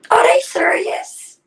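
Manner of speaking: high, slow incredulous; disbelief. Retold or imitated. Woman retelling her own shocked reaction at the funeral, high and incredulous. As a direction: ask it with stunned disbelief. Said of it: "I'm like".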